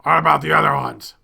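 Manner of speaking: masked creature calling after the leaving woman. Acted. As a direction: shout the question to her. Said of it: "calls out to her".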